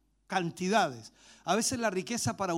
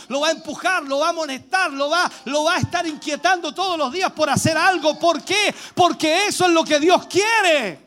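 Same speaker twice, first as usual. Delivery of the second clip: preaching that builds louder and louder; prédica a toda fuerza; 7 semitones higher, 8 dB louder than usual. Real, not acted. (prédica encendida que va subiendo)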